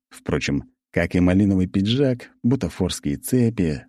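plain reading voice

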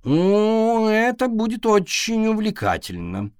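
high, mocking grinning voice; sneering, with a grin; sarcastic grin. A narrator's reading. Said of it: "осклабился кот".

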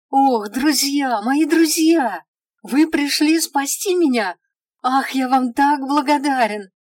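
high, slow, gushing relief; joyful elf exclaiming. A narrator's reading. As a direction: cry out happily to rescuers. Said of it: "воскликнул эльф, радостно улыбаясь".

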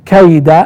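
كيد as kayda; fatha on كيد. Shown as a demonstration. Teacher showing the accusative, كيد ending in -a. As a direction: end كيد on a clear a. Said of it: "بالنصب".

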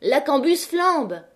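high, drawn-out cry of alarm; slow, high warning call. A narrator's reading. La Maheude warning the women about the fire, crying out. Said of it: "cria la Maheude".

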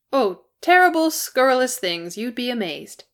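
high, loud, playful boast; bright. A narrator's reading. Dwarf teasing Bilbo about what they were up to, bright. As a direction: tease brightly, starting high and easing down. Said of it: "Beaufort said brightly".

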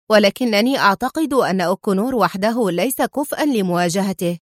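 ordinary delivery

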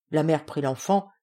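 usual speaking voice

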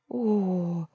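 stunned little exclamation; shocked gasp. Acted. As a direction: let the surprise push the voice up. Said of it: "шокированно выдохнула она".

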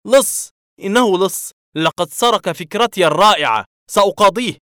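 very high, loud outraged yelling; indignant writer raging at a plagiarist; furious shout. A narrator's reading. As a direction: blood rushing to the head, screaming thief. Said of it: "صحت في عصبية".

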